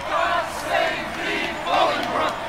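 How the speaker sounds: high, far-off cry of the crowd; crowd cheering. Acted. Narrator voicing the street crowd, a high distant cheer. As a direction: call it out like a cheering throng. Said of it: "whilst all tongues cried".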